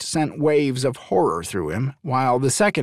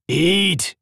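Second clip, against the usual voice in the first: a beast roaring for food, thrilled; eager dragon bellowing its want; excited dragon roar; 7 semitones higher than usual. A narrator's reading. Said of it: "his dragon roared out in excitement".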